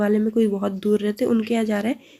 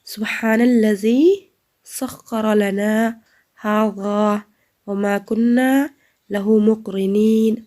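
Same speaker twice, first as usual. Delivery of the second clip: slow, louder measured Quranic recitation; recitation for students; 5 dB louder, much slower than usual. Shown as a demonstration. Teacher reciting the travel dua for her students. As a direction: intone each phrase slowly and clearly. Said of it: "let's start".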